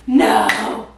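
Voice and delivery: loud, drawn-out mock no; mock outcry. Reviewer protesting the month's worst book, loud mock outcry. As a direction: cry it out in dramatic refusal.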